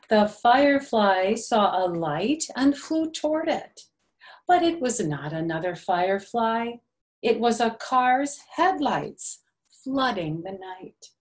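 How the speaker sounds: gentle, sing-song storybook reading; lightly lilting picture-book narration; reading to children. A narrator's reading. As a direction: read it like circle time for little ones.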